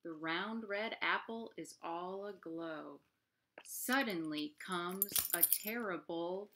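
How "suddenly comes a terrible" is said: loud dramatic plop. A narrator's reading. Narrator startling the listening child with a loud plop. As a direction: build to a big booming plop.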